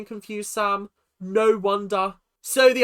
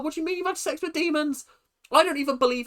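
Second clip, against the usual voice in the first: high, rushed disbelief; incredulous; 8 semitones higher, faster than usual. Acted. Viewer reacting to housemate's demon claim, incredulous. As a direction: blurt it out fast, high and freaked out. Acting out her freaked-out reaction.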